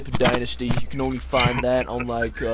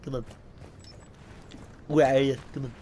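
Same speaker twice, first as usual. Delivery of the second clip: lightly voiced cartoon baby coaxing; a hint of a cartoon toddler calling his pal; Stewie Griffin; 7 dB quieter, faster than usual. Gamer coaxing Brian in play, light cartoon impression. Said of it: "I know I am Stewie".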